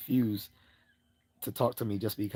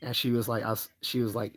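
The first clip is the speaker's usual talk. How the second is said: ordinary delivery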